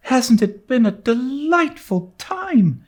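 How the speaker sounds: put-on girl sighing with delight after the concert; rapturous sigh. A narrator's reading. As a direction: gush it out on a happy sigh. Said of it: "sighed Anne rapturously".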